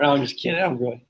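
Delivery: ordinary delivery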